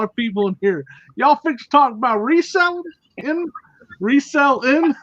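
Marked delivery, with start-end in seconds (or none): 1.1-4.9 put-on terrible Southern accent. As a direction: ham up a bad Southern accent. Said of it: "That was my terrible".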